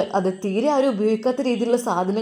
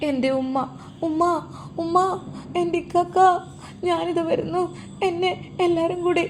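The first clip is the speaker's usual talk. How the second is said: put-on higher girl's voice, soft and desperate; a lost daughter crying out for her family, pitched up; frantic girl calling for mother; 9 semitones higher, 3 dB quieter than usual. (ഉമ്മയെ വിളിച്ചു കരയുന്ന പെൺകുട്ടിയുടെ ഉയർന്ന ശബ്ദം)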